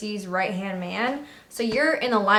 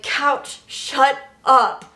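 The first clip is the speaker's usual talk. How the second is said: higher-pitched, urgent telling-off; scolding rack mate; 4 semitones higher, 4 dB louder, much slower than usual. Retold or imitated. Rack mate to a giggling recruit at inspection, scolding. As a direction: imitate a bunkmate urgently silencing you. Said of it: "my rack mate next to me, she's like".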